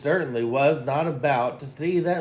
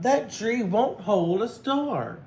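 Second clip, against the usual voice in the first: put-on higher, slow child's call; little bear calling; 7 semitones higher than usual. A narrator's reading. Little Bear calls to Barnaby from afar, higher and slow. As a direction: call it out from far off, little and high. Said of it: "cried out".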